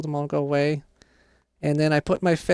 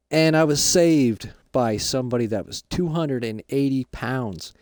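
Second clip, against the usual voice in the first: dad voice; 6 semitones lower than usual. Acted. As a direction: lower voice as the dad just out of prison. Kid voices his dad.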